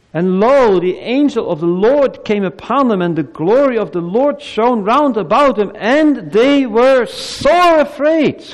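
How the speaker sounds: fervent reading of the shepherds scene, slowing on the last line; dramatic scripture reading. Acted. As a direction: swell with awe to the climax. Reads Luke nativity text dramatically.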